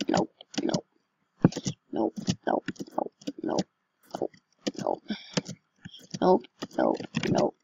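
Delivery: breathy whispered nopes; hushed panicked nope. Real, not acted. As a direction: whisper it, creeping away scared.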